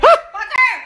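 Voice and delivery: loud startled shriek; frightened scream. Real, not acted. Cockroach scare, screaming.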